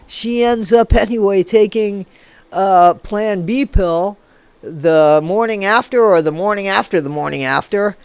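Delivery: ordinary talk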